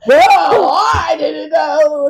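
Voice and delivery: loud, snooty imitation of the guest; haughty mock. Guest snapping at Mary Beth over her seat, snooty and loud. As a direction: toss it off with offended superiority.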